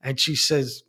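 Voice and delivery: ordinary talk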